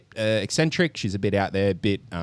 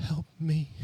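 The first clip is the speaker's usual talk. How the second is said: higher, small plea; lightly voiced boy begging for help; pleading kid; 9 semitones higher than usual. Boy on the ground pleading to his foster mum, higher and small.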